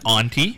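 "auntie" with an open ah; auntie as ahntie. Retold or imitated. Host quotes a woman's word, "auntie" said AHN-tee. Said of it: "Did you hear Linda last night?".

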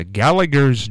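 "Gallagher's" said with a hard g in the last syllable; hard g pronunciation. Real, not acted. Radio host recalls how he had been pronouncing it, "Gallagher's" with hard g. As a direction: pronounce "Gallagher's" as GAL-uh-gurz. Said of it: "which is how I'd been pronouncing it".

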